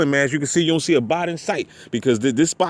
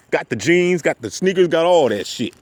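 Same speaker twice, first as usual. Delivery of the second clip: normal talking voice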